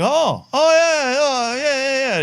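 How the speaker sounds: hammed-up high, drawn-out "oh yeah" from the clueless kid; fake recognition. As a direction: play the kid pretending he knows the star. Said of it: "the kid's like".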